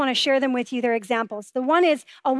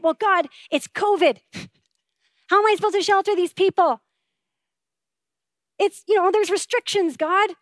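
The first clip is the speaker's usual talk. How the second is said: higher, faster pleading excuse; whiny excuse to God; 4 semitones higher than usual. Retold or imitated. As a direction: make excuses to God, a bit rushed and whiny. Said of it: "And I said".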